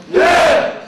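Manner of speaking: loud, high military yes; shouted answer. Real, not acted. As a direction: bark it back to the instructor. Recruits answer instructor in unison.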